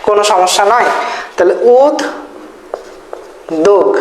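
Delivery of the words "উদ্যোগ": উদ্যোগ as ud, long pause, dog; split d-sound variant. Shown as a demonstration. Teacher dictating one valid spelling by sound, split in two. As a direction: break it slowly into ud and dog. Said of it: "এটাও হইতে পারে".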